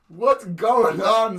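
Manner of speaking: loud, high, slow bewilderment; alarmed shout. Retold or imitated. Imitating tentmate confused by the screaming, alarmed shout. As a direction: bellow it drawn out, high.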